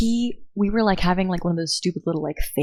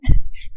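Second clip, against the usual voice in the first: laugh; 7 dB louder than usual. Real, not acted. Laughs after quote.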